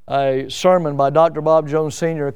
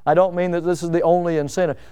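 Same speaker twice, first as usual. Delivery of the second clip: ordinary talk, nothing marked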